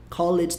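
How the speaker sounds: "Called" broken into "call-ed"; two-syllable "Called"; wrong pronunciation. Shown as a demonstration. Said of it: "Called tá errado".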